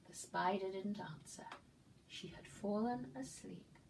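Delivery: ordinary delivery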